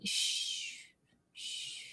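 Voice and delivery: a soft breathy shh; shushing. Real, not acted. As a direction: hush them playfully. Hushing jokingly.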